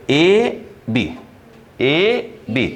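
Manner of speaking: very slow, letters pulled apart; drawn-out spelling. Shown as a demonstration. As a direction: spell out "एबी" letter by letter, slowly. Spelling out the notation for students.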